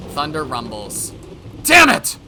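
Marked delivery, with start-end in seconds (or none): 1.6-2.2 loud, high burst of panic; panicked cursing. Acted. Yoshimura near tears as thunder rumbles, panicked. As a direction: curse out in fright. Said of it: "his face on the verge of tears".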